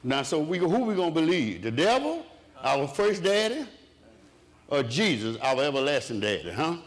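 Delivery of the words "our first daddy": normal talking voice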